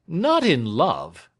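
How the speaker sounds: Snitchey jolted by the news; cried in alarm. Acted. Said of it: "cried Snitchey".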